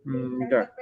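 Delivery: normal talking voice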